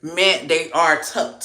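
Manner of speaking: ordinary talk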